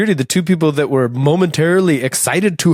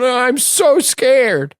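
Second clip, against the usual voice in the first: mock scared; 10 semitones higher than usual. Retells himself lost and drunk.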